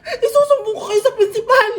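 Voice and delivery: high, wailing mock threat; frantic threat. Abandoned teacher threatening to tell the principal, frantic. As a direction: cry out a threat in comic outrage.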